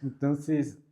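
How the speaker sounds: slow, high and loud, still laughing. Real, not acted. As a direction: drawl it out while the laugh fades.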